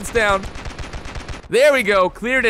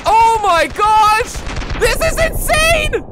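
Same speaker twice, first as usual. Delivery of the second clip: much higher, louder, slow yelling in disbelief; excited shout; 8 semitones higher, 3 dB louder, slower than usual. Real, not acted. Gamer yelling at a massive kill streak, excited shout. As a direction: let it burst out, real amazement. Hundreds of kills at once.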